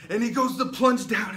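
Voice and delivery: very loud, high, urgent telling; climactic shouted narration. A narrator's reading. Preacher at the knife's plunge, shouting the climax. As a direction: shout it at the peak of the story.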